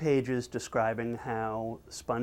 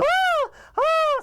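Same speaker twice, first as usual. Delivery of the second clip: piercing, very high loud shriek; cartoon scream; 7 dB louder than usual. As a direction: scream at full pitch like a terrified cartoon. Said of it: "It's still going on".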